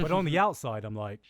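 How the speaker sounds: ordinary talk, nothing marked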